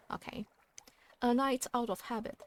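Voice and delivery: ordinary delivery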